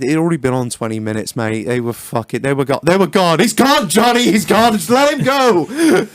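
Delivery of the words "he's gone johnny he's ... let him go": high, loud hammed-up grief; mock dramatic. Mourning earbuds lost in the wash, hammed-up movie drama. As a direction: play it like a war-film death scene.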